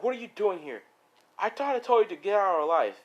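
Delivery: indignant, high-pitched telling-off; angry confrontation. Acted. Wife angrily confronts returned husband.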